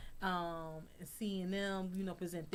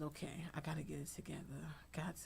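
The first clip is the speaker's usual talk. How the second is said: self talk; 3 semitones lower, 11 dB quieter, faster than usual. Retold or imitated.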